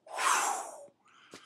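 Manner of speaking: long, breathy flung-through-air whoosh; airy cartoon swoosh; whooshing noise. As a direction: blow out a voiceless sweep of air. Imitates the cougar being flung.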